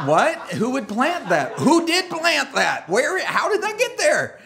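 high, incredulous questions, slowing on who did plant that; mock bafflement. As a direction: act baffled at the weed. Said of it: "You're like".

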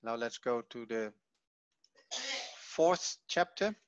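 ordinary talk

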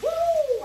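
high, joyful whoop; excited whoop. Real, not acted. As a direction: shout it out with delight. Frying, about to sip.

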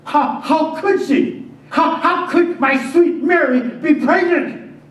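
high, loud and slow, reeling; anguished disbelief. Acted. Joseph reeling at Mary's pregnancy, anguished. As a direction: cry it out as if struck by thunder. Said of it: "The words exploded in my mind".

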